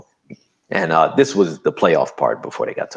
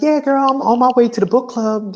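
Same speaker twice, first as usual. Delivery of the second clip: high chatty nurse voice on the phone; breezy, much higher, girlfriend chatter; imitating the nurse; 4 dB louder than usual. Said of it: "she's like".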